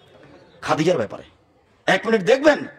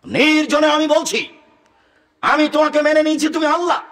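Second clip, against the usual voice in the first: high, loud, slowed preacher cry; fervent sermon delivery; preaching at full force; 9 semitones higher, 4 dB louder than usual. Acted. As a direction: lift the hall with the prayer.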